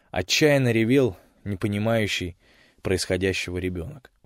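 plain reading voice

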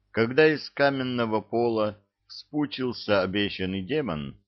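usual speaking voice

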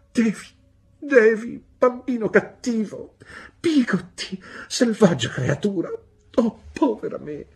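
very high, loud, self-pitying cry; petulant wailing. A narrator's reading. Mother turning on child and Pigotty, wailing petulantly. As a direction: wail fretfully like a spoilt, hard-done-by woman. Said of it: "pianse mia madre ... stizzosa e capricciosa maniera".

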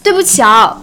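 usual speaking voice

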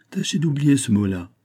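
brooking no reply. A narrator's reading. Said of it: "d'un ton qui n'admettait pas plus de réplique".

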